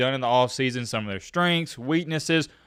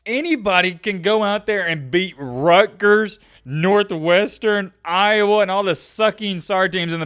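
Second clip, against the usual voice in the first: heated rant; 6 semitones higher, 5 dB louder than usual. Real, not acted. Ranting about weak opponents.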